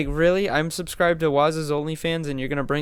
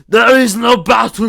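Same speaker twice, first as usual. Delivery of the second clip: loud slow higher shout; shouted line; 6 semitones higher, 10 dB louder than usual. Acted. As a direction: bellow it out dramatically. Imitation bit, shouted.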